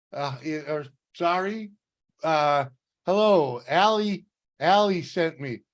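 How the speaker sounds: ordinary delivery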